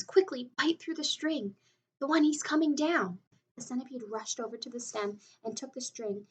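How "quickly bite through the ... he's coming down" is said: louder, higher urgent order; urgent yell. A narrator's reading. James yells at the centipede to cut the string. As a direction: call out the command fast. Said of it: "yelled James".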